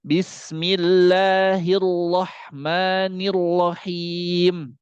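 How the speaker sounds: "Bismillahirrahmanirrahim" very slow with a heavy, thick ra; correct thick ra. Shown as a demonstration. Teacher showing the correct thick ra in basmalah. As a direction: draw out "Bismillahirrahmanirrahim", ra full and thick. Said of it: "langsung ke rahang tebal".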